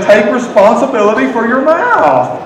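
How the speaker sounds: hammed-up jab with laughter around it; mock scolding. Telling your spouse to take responsibility, mock scolding.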